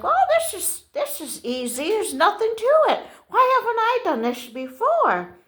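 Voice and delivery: high-pitched, pleased self-talk; lightly acted delighted surprise; breezy confidence. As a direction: marvel at how easy it is. Retelling her own breezy confidence.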